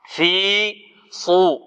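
very slow, chanted في صو; slow recitation demo. Shown as a demonstration. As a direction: draw out the heavy sad. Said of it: "الصاد مفخمة".

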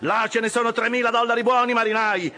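high, frantic begging of the crew; excited, pleading cries. A narrator's reading. Officer hopping about, begging his rowers to speed up, crying high. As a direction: implore them, pitch leaping up on each cry. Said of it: "gridava Flask saltando su e giù".

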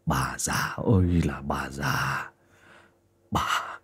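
low, slow whispered hiss. Acted. Hoàn hisses at his mother after slapping her, slow whisper. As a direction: hiss it through your teeth. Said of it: "mà rít lên".

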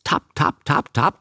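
light, voiceless tapping steps; imitated footsteps. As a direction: tap out the creeping feet with your voice. Imitates creatures' footsteps at night. (nachgeahmtes Tapsen)

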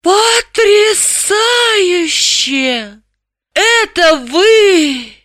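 very high, hugely drawn-out rapture; soaring, swooning exclamation; stunned amazement. A narrator's reading. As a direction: stretch every vowel in dazed wonder. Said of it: "не сводя очумелых глаз".

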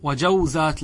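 وجوزات said "wa-jawzāt", closed diphthong; waw left unchanged. Shown as a demonstration. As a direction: repeat وجوزات as jaw-zāt, no added vowel. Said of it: "لا نغير شيئا". (وجوزات بسكون الواو)

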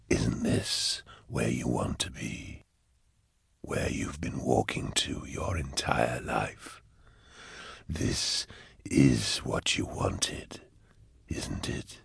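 low, slow whispered taunt; eerie unseen demon. A narrator's reading. Demon voice tells Eisenhorn he wanted this, eerie whisper. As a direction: whisper it slowly, deep and drawn out.

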